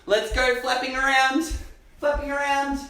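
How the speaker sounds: normal talking voice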